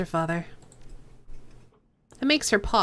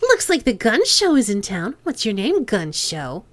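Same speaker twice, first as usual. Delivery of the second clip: a light nasal Hong Kong gangster lilt, teasing; Chang's nasal accent. Acted. Boss Chang sizing up the cop, light nasal accent. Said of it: "to do Kindly Chang's accent".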